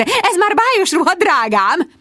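magas hangú, csicsergő anya; odavetett anyai megjegyzés. A narrator's reading. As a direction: vesd oda magas hangon, futtában. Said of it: "mindannyiszor odavetette". (magasan odavetett megjegyzés)

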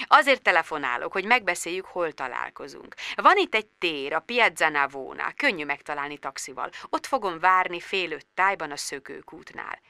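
very soft, suave phone voice; gentlemanly nonchalance. A narrator's reading. Actor arranges a Rome meeting by message, nonchalant. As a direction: understate it, quietly trailing off. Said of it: "úri, mély, nem törődöm hang".

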